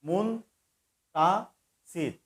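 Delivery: মুন, তা, সির with a pause between each; split into syllables. Shown as a demonstration. Teacher breaks the name Muntasir into syllables. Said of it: "এটিকে তিন ভাগ হয়ে যাচ্ছে".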